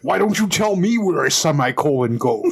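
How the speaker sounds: practised celebrity impression; Jesse Ventura. Co-host shows off a practised voice, Ventura impression. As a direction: challenge them in a put-on tough-guy voice. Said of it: "you tell me how my Jesse Ventura is".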